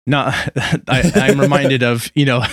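ordinary delivery

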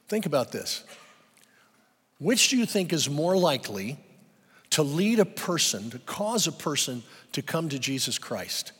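normal talking voice

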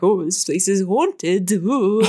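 drawn-out mock haunted-house voice, slow; slow ghostly wail, played for laughs; mock spooky. Dreamer describing the ghost house, mock spooky. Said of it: "were basically ghosts".

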